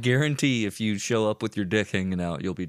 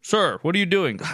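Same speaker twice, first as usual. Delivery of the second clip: higher, louder officious cop voice; mock cop; 4 semitones higher, 3 dB louder than usual. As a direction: question him like a stern cop. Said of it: "voicing a cop in a bit".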